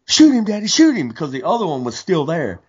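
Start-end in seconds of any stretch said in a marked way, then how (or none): 0.1-1.1 put-on high, eager little-girl pleading; imitating an excited little girl. Girl urging her dad to shoot the turkey, high and eager. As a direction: squeal it up high like an excited kid. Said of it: "you hear her saying".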